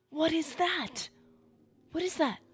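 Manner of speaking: high, loud, startled cry; loud alarm. Real, not acted. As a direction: cry it out in shocked disbelief. Alarm at strange creature grows.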